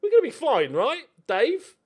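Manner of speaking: put-on a German fan reassuring Dave, camp German accent. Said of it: "turning to each other and going".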